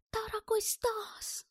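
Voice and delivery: high, drawn-out German-character greeting; joyful exclamation. A narrator's reading. German greets Stas on the street, joyful exclamation. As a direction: exclaim it high and slowly, without shouting. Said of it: "воскликнул он".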